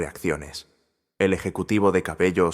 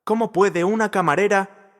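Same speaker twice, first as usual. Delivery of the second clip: pitched far up, louder, cut off mid-question; a flustered manager breaking off, desperate; desperate protest; 13 semitones higher, 4 dB louder than usual. A narrator's reading. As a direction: plead in disbelief. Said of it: "protestó Santiago desesperado".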